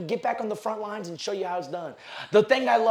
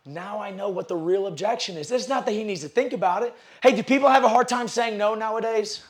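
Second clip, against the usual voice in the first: ordinary talk, nothing marked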